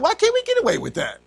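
high, quick mock whine; protesting grandchildren. Children envying grandfather's short verse, high mock protest. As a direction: complain like a child denied a shortcut. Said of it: "We're like".

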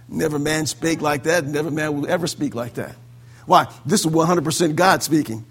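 ordinary talk

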